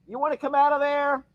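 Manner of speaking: pet talk. Real, not acted. Talking to animals.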